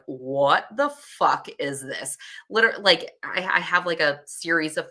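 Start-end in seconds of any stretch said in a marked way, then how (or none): 0.1-2.2 slow, put-on voice for the baby; baby's outraged thought. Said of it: "looks up at her brother and is like".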